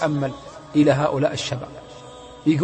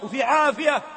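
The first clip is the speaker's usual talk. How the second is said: rising, urgent sermon voice; fervent preaching; 9 semitones higher than usual. Acted. The preacher admonishes the young, fervent. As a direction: warn them with fire.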